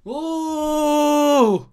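loud sudden whoa; startled shout. Real, not acted. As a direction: jolt of surprise, shouted. Startled yelp in game.

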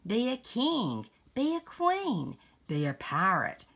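lively picture-book read-aloud; reading to children. Acted. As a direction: read it like a bedtime story to a grandchild.

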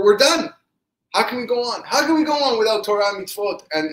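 high, loud hopeless wail in words; despairing cry. Acted. Rav Zusha without Torah or prayer, acted despairing cry. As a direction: plead at the top of your range, despairing. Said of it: "hearing his brother cry".

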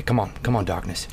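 normal talking voice

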